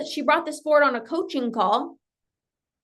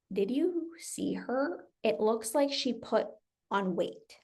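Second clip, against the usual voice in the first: soft, slow, hushed voice; son's snide remark, hushed and slow; quiet quoted jab; 3 semitones lower, 10 dB quieter, slower than usual. Retold or imitated. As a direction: murmur it like a snide aside. Son's comment about her body.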